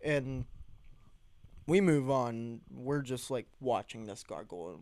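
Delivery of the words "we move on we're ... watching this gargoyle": normal talking voice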